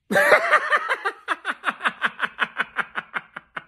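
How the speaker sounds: high cackling laugh; gleeful laugh. Real, not acted. Fan cackling after taunting the Cowboys, gleeful laugh. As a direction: let the laugh burst out. Gloating over rival's loss.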